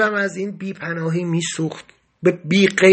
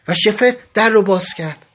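usual speaking voice

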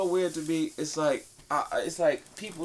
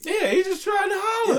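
mock shout; 5 dB louder than usual. Mocking Morgan Freeman.

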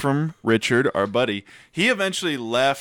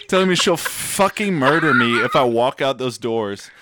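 ordinary talk

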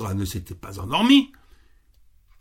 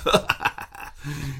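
a short mocking laugh from the fox; sly fox chuckle. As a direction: chuckle like a pleased villain. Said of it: "le renard ne fit qu'en rire".

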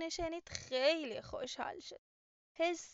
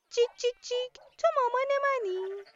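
high, loud chirpy chick voice cheeping its question; a tiny chick calling for its mum, higher and louder; little lost chick; 9 semitones higher, 9 dB louder than usual. Acted. Lost chick asks the cow for its mum, high chirpy cheep. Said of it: "رفت پیش اونو پرسید".

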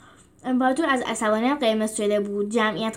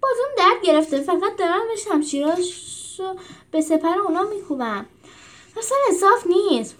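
higher whiny moan; 9 semitones higher than usual.

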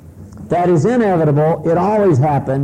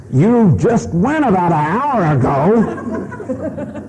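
scolding wife; 4 semitones higher, much slower than usual. Retold or imitated. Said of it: "She says".

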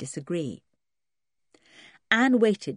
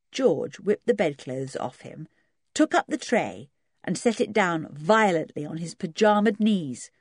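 plain reading voice

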